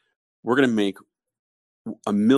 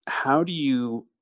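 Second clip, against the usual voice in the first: ordinary talk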